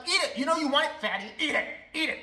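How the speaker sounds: loud, high taunting; pushy cupcake pusher. Pretend. [name] pushing cupcakes on strangers, loud taunting. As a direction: taunt someone to eat it.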